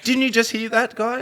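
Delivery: a mum losing patience, voice shooting up; shrieking mock outrage at her son; exasperated mum.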